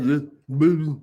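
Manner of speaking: lightly voiced low oily lawyer; smooth lawyer. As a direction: croon it softly like a slick lawyer. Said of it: "He would be like".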